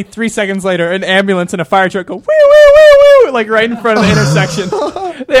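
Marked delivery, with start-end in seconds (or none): 2.2-3.3 siren wail. Retold or imitated. Imitating siren.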